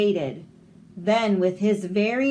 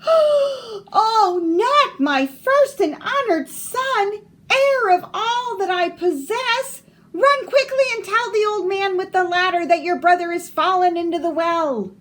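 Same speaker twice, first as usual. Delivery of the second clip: much higher and louder, distressed; alarmed mother crying out; 11 semitones higher, 7 dB louder than usual. Acted. Mother panics as her eldest falls into the well. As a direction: cry out in panic for the firstborn.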